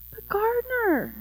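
high-pitched plaintive plea; protesting Little Tree. A narrator's reading. Little Tree appeals to the gardener over her cut branch, plaintive. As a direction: whine it up high like a hurt child.

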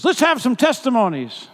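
high, loud announcement; calling out. Retold or imitated. Garland invites the men to testify, calling out loudly. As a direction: call it out to the whole room.

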